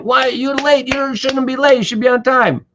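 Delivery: high, indignant reproach; high-pitched annoyed telling-off; angry scolding. Retold or imitated. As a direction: scold someone for being late. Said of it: "if you get mad".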